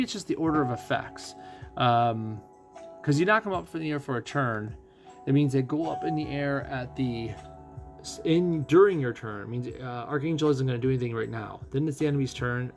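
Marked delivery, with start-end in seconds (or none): none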